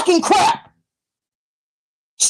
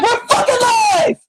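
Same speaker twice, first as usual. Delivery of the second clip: slow, high shout of "live" with a laugh; exasperated laughing shout; 7 semitones higher than usual. Real, not acted. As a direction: bellow it out, half laughing. Mocking the mic being live.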